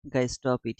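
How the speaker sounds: ordinary delivery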